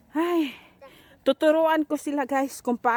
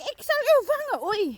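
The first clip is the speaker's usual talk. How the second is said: playful chase call; 10 semitones higher than usual. Pretend. Playful chase threat to child.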